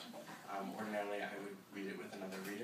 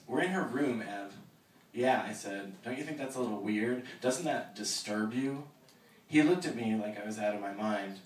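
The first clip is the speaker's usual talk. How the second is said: steady reading of a novel aloud; story narration; 7 dB louder than usual. Acted. Author reading her novel to the audience, measured narration.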